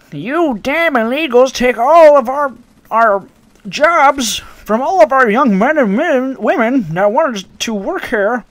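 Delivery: high, loud mock old lady rant; hammed-up furious granny yelling about jobs. Racist customer voiced in story.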